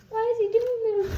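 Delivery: frustrated outburst at the bird; exasperated. Real, not acted. Bird won't leave shirt.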